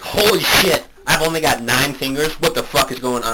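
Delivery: loud, high-pitched panicked disbelief; mock shock. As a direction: act out waking up to a horrifying discovery. Acting out shock at missing finger.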